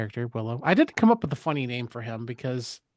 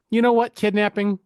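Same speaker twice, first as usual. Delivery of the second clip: high, loud, breezy mock decision; imitating someone impulsively deciding; mock sudden resolve; 7 semitones higher, 6 dB louder than usual. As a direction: pitch it up as a jokey bright idea. Acts woman's sudden decision.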